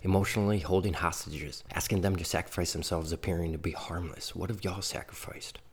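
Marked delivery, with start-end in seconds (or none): 4.4-5.6 whispered accusing question. Acted. Poet challenging the listeners, whispered question. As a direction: hiss the question quietly.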